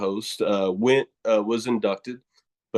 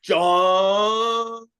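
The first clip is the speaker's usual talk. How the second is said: high, drawn-out Southern "John"; playful long, high Southern vowel; Southern accent; 10 semitones higher, 4 dB louder than usual. Pretend. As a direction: stretch the name in a hammed-up Southern drawl. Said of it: "that Southern accent".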